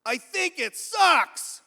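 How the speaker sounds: high, drawn-out yell; shouted verdict. Retold or imitated. Bathroom stranger giving his verdict on the replacement, shouted. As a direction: bellow it slowly, stretching the last word.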